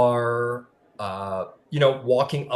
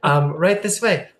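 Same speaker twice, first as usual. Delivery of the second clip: a lightly put-on French accent, polite host; 6 semitones higher, 5 dB louder than usual. Greeter welcoming Cross aboard, lightly French-accented. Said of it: "I keep turning into a French person".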